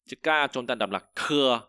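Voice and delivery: normal talking voice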